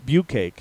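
bukkake said like "byoo-cake"; Anglicized bukkake, rhyming its end with cake; own pronunciation. Real, not acted. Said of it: "is what I say".

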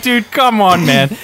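loud, high exasperated "come on"; mock exasperated shout at a buddy; exasperated retold yell. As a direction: throw up your hands, loud and pleading.

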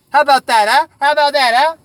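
high, loud goading repeat; loud taunting dare; taunting challenge. Acted. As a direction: goad them to fight.

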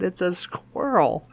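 slow, spelled-out exasperation; dad patiently stating the obvious; exasperated. Retold or imitated. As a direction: say it slowly like it should be obvious. Father spells it out.

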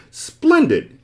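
the dodecahedron praising a right answer, delighted; excited cry. Acted. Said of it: "cried the dodecahedron".

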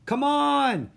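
high, loud call to a friend; bright-eyed girl calling out from the water; excited shout. A narrator's reading. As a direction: shout it out, drawing out the end. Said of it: "Annie shouted".

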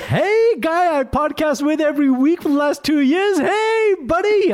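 very high, loud American-Canadian hey buddy. Retold or imitated. Mocking cohost with a cheesy American-Canadian greeting. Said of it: "becoming American Canadian as well".

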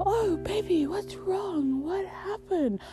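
very high, cooing imitation of a girlfriend; mock concern. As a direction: coo it sweetly, mocking a worried partner. Mimics partner's concerned cooing.

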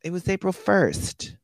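high, slow teasing reveal; gleeful reveal. Retold or imitated. Wife revealing the April Fools prank, high and slow. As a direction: drop the punchline sweetly.

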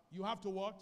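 ordinary delivery

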